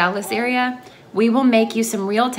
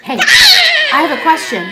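calling out; 10 dB louder than usual. Real, not acted. Calling to her child.

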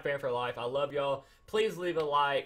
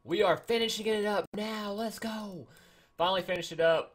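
higher, hyped-up announcement; excited; 7 semitones higher, 4 dB louder than usual. Real, not acted. Gamer telling viewers the long grind is finally finishing, excited. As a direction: let it rip, pitched up and pleased.

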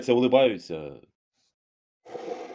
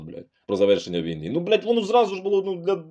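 normal talking voice

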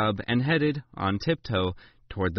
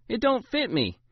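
put-on whiny, higher child protest; complaining; 12 semitones higher than usual. A narrator's reading. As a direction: complain like a sulky kid. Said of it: "I complained".

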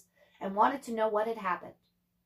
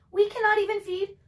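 sighing mother; 10 semitones higher, 5 dB louder than usual. Acted. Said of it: "Ma sighed".

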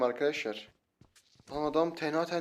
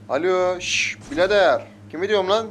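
loud, higher-pitched hailing; calling out; 5 semitones higher, 8 dB louder, slower than usual. Real, not acted. Gamer hailing a player who ignores him, calling out. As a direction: call after someone who is ignoring you.

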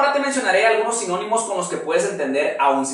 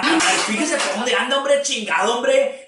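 mock angry rant; 5 semitones higher than usual. Acted angry outburst in skit.

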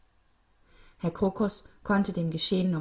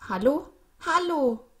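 high, loud calls for help; anxious raised-pitch calling; calling for attention; 9 semitones higher, 6 dB louder than usual. A narrator's reading. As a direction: call out from inside a closed bin. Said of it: "versuchte, sich bemerkbar zu machen".

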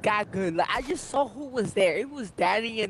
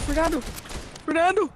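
calling for help; 7 semitones higher, faster than usual. Real, not acted. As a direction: shout his name like you're going down. Getting shot, calls teammate for help.

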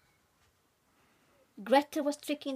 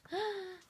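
sharp gasp; 8 semitones higher, 6 dB quieter than usual. Acted. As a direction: gasp in shock. Adult reacts to the story.

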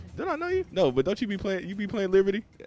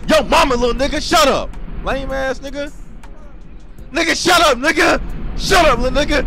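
shouting; 13 semitones higher, 12 dB louder than usual. Real, not acted. Trash-talking a player in game.

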